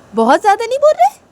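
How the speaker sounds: high, loud retort; acted squabbling child; indignant challenge. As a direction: challenge him sharply, voice rising. Sibling quarrel retort.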